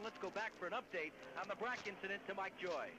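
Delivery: usual speaking voice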